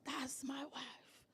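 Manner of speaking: hushed, slow whisper of a proud husband; mock husband whisper. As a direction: breathe it out like a husband showing off his wife. Husband's reaction voiced in whisper.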